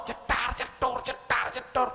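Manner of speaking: sharp voiced bang sounds; firecracker noises. Preacher mimics firecrackers going off during maulid, sharp pops. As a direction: spit the pops out like fireworks.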